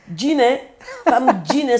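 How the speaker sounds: says the nickname through a real laugh. Real, not acted. Recalling her Antilles nickname to the host, laughing.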